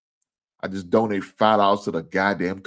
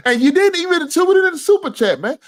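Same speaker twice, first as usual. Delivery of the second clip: much higher and louder, outraged; indignant yell; 6 dB louder than usual. Real, not acted. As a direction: scold him at full volume. Ranting at chat viewer.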